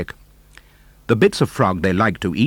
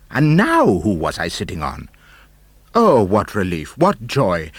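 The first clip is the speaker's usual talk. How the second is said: ordinary delivery, nothing marked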